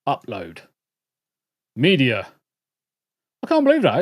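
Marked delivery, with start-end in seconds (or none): 3.4-4.0 disbelief. Real, not acted. Stream video fails.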